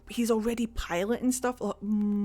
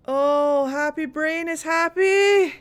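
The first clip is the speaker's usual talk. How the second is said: higher, louder and slow, blissful repeat; delighted squeal; 7 semitones higher, 5 dB louder, slower than usual. Real, not acted. Gamer squealing with delight over the pilot.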